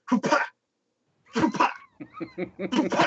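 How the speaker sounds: 공 던질 때 내는 기합 소리 흉내; 훕파 하고 짧게 끊어 외치기; imitated throwing grunts. Imitating hard pitching grunts.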